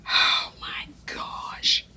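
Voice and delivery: slow, awed whisper; soft whispered wonder. Real, not acted. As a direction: breathe it out in awe. Hushed awe at the food.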